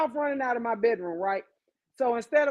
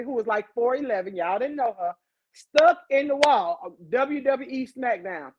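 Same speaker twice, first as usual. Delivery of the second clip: normal talking voice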